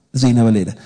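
usual speaking voice

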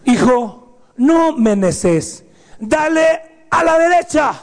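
high, sharp shout at the son; annoyed father. Retold or imitated. Father snapping at son who insists on left. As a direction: snap the order at him.

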